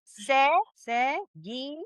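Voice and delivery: "Se, seguí" slowly, one syllable at a time; slow phonetic breakdown of "Se, seguí". Shown as a demonstration. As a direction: sound out "Se, seguí" for a learner. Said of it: "I want you to put this in here".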